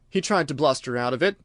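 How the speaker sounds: plain reading voice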